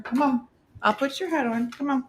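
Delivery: sweet coaxing call to the dog; talking to a pet. Real, not acted.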